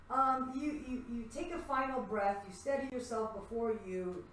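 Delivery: normal talking voice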